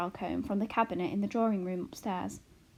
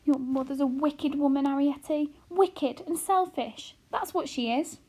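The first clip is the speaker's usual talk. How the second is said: mother near tears, higher and strained; trembling voice; 5 semitones higher, 4 dB louder than usual. A narrator's reading. Homily condemning herself to her daughter, trembling. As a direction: berate herself with a quivering voice. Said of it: "Homily's voice began to tremble".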